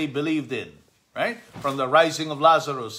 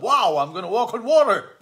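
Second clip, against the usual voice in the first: higher, eager wow; thrilled to try it; excited Peter; 9 semitones higher, 5 dB louder than usual. Acted. Peter thrilled to step onto water, eager.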